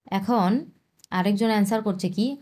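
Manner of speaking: normal talking voice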